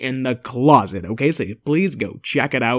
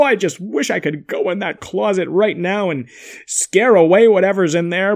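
ordinary talk

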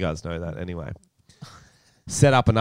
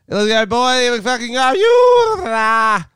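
loud, high, pumped-up yelling; hyped shouting chirp; 13 semitones higher, 8 dB louder, much slower than usual. Shown as a demonstration. Showing his mate how he chirps, hyped shout. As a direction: fire up the team with big yells. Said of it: "Give us an example".

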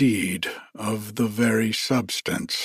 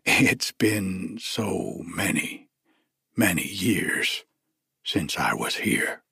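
instantly serious; 3 semitones lower, 4 dB quieter than usual. A narrator's reading. Traveller explaining his long absence to the lady, instantly serious. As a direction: turn serious, letting it sink lower and softer. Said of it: "But he was instantly serious".

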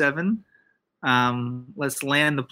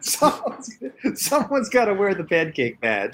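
laughing; 5 semitones higher than usual. Real, not acted. Laughing while speaking.

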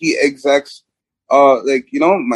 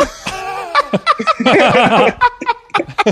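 long high cackle of laughter. Real, not acted. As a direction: laugh till it fades. Laughing after impression.

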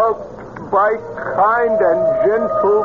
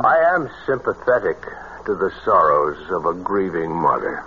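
mystic voice; 4 dB quieter, much faster than usual. Acted. Fake mystic speaks in deep voice.

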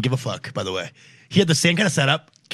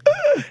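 very high startled uh; hammed-up squeak; squeaky mock grunt; 4 dB louder than usual. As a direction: yelp it like a caught kid. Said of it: "And he's like".

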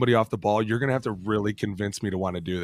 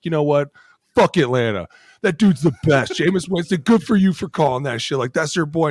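mock fan cheering; 6 semitones higher, 5 dB louder than usual. Voices fans cheering Winston's defiant call.

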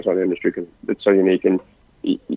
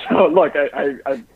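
usual speaking voice

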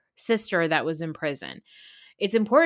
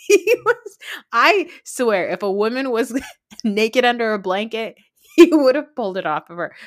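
laughing; 3 semitones higher, 7 dB louder than usual. Real, not acted. Laughing through outrageous story.

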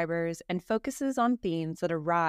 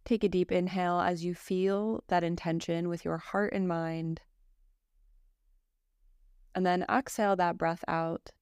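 calm, even yoga nidra guidance with long pauses; guided meditation; 2 semitones lower than usual. Acted. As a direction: guide a listener gently into rest, phrase by phrase.

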